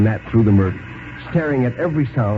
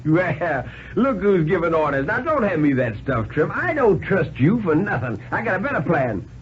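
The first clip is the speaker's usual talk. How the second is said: higher, jeering hobo laughing off orders; scornful tramp; 7 semitones higher than usual. A narrator's reading. Tramp laughing off the banker's orders with scorn. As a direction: sneer at the banker's offer.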